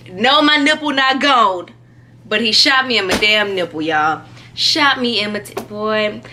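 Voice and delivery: ordinary talk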